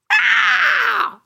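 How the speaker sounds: loud, very high yelled "ah"; full-voiced high roar; monster scream. A narrator's reading. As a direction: scream out like a kid playing monster. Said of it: "gridò".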